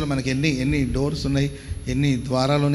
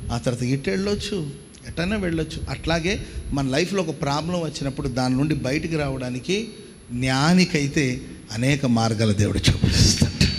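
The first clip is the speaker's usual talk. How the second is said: ordinary talk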